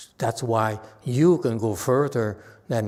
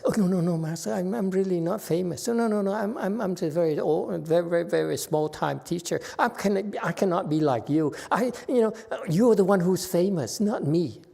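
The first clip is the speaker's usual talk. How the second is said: high, fluttery self-deprecating protest; mock fake-polite student, much higher; falsely modest student; 6 semitones higher than usual. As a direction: play the overly humble disciple, pitched up. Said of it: "Frances would say to her master".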